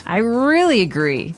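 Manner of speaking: slow, stressing "really"; emphatic agreement. Shown as a demonstration. Host demonstrating an emphatic "I really agree". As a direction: drag out "really" to show strong agreement. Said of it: "this is very emphatic".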